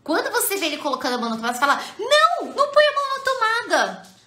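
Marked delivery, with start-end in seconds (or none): 2.0-4.2 much higher, louder panicked no; alarmed parent shouting at child. Shown as a demonstration. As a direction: yell it like the child is about to get hurt. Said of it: "Você muda completamente".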